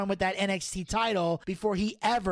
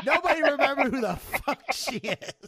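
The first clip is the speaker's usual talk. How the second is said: laughing; 4 semitones higher than usual. Real, not acted. Laughing, mocking.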